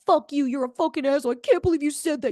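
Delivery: mock angry outburst. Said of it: "instead of me going to him being like".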